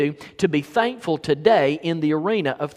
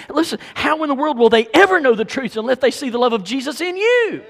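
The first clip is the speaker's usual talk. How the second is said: raised voice climbing to a high, loud peak; impassioned preaching; 10 semitones higher, 7 dB louder than usual. Real, not acted. Preacher challenging believers to show love, impassioned and high. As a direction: press the challenge home, pitch soaring at the end.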